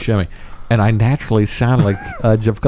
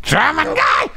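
very high squeaky shout; high-pitched German guy yell; slower than usual. Retold or imitated. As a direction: yelp it in a shrill game voice. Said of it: "screaming high-pitched voices".

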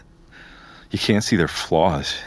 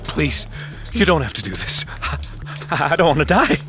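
high, faltering plea for life; desperate begging; 10 semitones higher than usual. Acted. Captive begs captor for his life, desperate pleading. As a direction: plead with your killer, voice climbing. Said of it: "I beg for my life".

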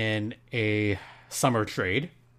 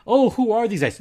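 higher-pitched mock puzzlement; kidding; 10 semitones higher, 3 dB louder than usual. Host pretending not to know the players, kidding. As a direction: play clueless as a joke. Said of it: "I'm partially kidding".

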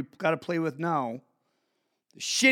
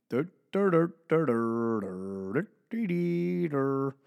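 mock zombie drone; 5 semitones lower, 3 dB quieter than usual. Mom zoned out on phone.

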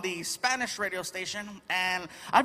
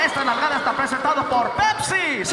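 loud shouted on-air sí; radio host shout; 7 semitones higher, 6 dB louder than usual. Pretend. Imagined radio guy shouting his sponsor line at home. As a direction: bellow it like a sponsor plug. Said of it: "talking like that".

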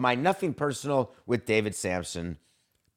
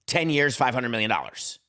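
usual speaking voice

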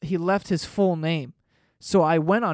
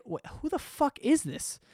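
baffled; 9 semitones higher, 5 dB quieter than usual. Retold or imitated. Confused by a strange letter.

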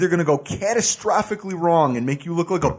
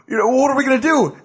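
quoting Bill Murray, higher and faster; podcast host doing Bill Murray, higher and faster; Bill Murray line; 7 semitones higher, faster than usual. Retold or imitated. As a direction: toss it off like Bill Murray asking the team. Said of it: "and he goes".

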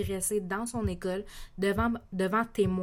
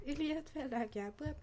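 higher and softer, playful repeat; high soft teasing; 7 semitones higher, 8 dB quieter than usual. Acted. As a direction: sing it back up high and soft. Teasing line said twice.

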